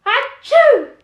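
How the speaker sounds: big, loud, high dragon sneeze; huge sneeze. Acted. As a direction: let the sneeze explode. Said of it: "he sneezed".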